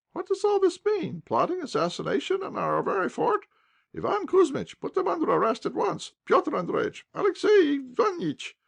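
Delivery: high, loud shrill scolding; a fussing matron in full outrage; scolding old wife. A narrator's reading. Commandant's wife scolds the duellists, loud and high.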